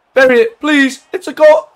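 loud, high cry at a chance on goal; excited pleading shout. Real, not acted. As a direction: shout it, sure the goal is coming. Begging his striker to score.

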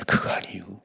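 soft breathy unvoiced denial; whispered correction. Real, not acted. Pastor whispering to the congregation that it is not their own work. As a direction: hush the correction.

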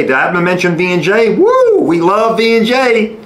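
high, whooping sponsor cheer; excited sponsor hype. Real, not acted. Host cheering his sponsor with a whoop.

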